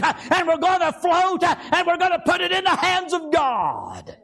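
high, shouting revival preacher telling the flood story; fiery preacher shouting out the story; preaching at full force. Acted.

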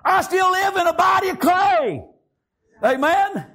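high, driving preacher's delivery; full-force preaching. Real, not acted. Preacher vowing to serve the Most High God, full-force preaching. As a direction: push each phrase up high, relentlessly.

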